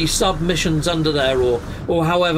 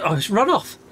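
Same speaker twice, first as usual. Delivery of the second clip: ordinary delivery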